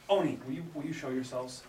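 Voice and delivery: plain reading voice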